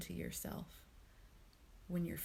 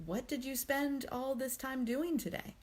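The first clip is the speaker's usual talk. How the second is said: higher, reproachful self-questioning; critical inner voice; 7 semitones higher than usual. Acted. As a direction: take yourself to task over wasted time. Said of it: "That critical tone of voice".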